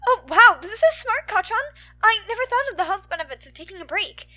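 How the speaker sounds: high, loud fanboy gush; excited gushing. Acted. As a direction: gush with wide-eyed admiration.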